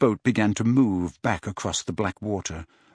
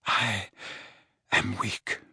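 put-on softer, frail, exhausted old man; weak old wizard; 3 semitones lower, 7 dB quieter, slower than usual. A narrator's reading. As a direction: let the strength drain from it.

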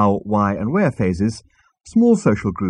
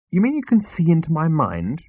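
high-pitched incredulous question; astonished; 8 semitones higher than usual. A narrator's reading. Arthur learning Marvin reads minds, astonished. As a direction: ask in rising disbelief. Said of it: "Arthur stared in astonishment".

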